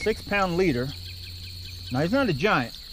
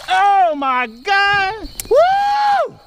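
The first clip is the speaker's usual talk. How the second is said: excited shout; 6 dB louder, much slower than usual. Real, not acted. Huge bass landed, celebrating.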